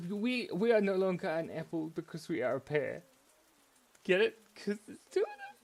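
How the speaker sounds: high, loud joke delivery; hammed-up punchline. Storyteller landing his pear pun, hammed-up excitement. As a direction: push the pun, pitch climbing.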